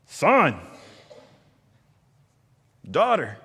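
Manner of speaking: loud, high call across a park; parent calling out. Retold or imitated. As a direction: call your child over the noise.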